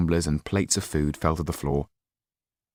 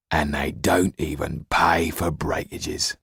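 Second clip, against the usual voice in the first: slow, breathy whispered grumble; bitter whisper; slower than usual. Acted. Tull mutters to himself about breakages, slow whisper. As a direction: mutter it under your breath, aggrieved.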